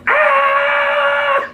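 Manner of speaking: very high, loud mock scream; hammed-up shriek of terror, way up high; scream like a girl. Buddy in the passenger seat as the car nearly rolls, high mock scream. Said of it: "Just screaming at the top of his lung".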